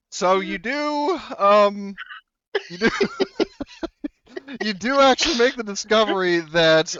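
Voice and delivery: high, loud, broken up by laughter; laughing. Real, not acted. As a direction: try to announce it through real laughter. Game master cracks up announcing result.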